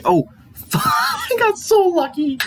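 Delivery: laughing with delight. Real, not acted. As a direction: giggle through it, thrilled. Lucky cursor find, laughing.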